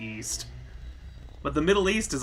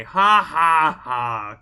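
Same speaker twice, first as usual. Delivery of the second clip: mock laugh; 3 semitones higher, 12 dB louder, much slower than usual. Mock laugh at game character.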